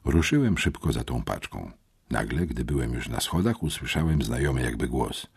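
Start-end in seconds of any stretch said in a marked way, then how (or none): none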